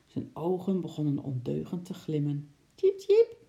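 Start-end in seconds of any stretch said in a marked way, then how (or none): 2.8-3.4 very high, loud chirp; squeaking little animal. Acted. Little Eki to Sjimp, eager to join in, squeaking. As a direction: squeak like a tiny squirrel. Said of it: "piepte Eki".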